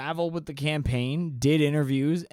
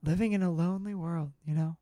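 a hint of a far, dreamy narrator; lightly hammed lyric recital; mock sincere; 3 semitones higher, 4 dB quieter than usual. Parodying Don't Stop Believin lyrics.

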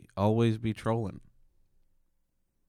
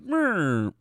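high, silly mock troll; online troll voice; 9 semitones higher, slower than usual. First online troll threatening someone, high silly voice. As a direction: whine it out as a cartoon menace. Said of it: "The first online troll said".

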